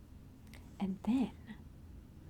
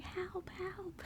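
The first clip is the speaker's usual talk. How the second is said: whispered mock cry for help; 9 semitones higher, 8 dB quieter than usual. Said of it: "He was screaming and yelling and was like".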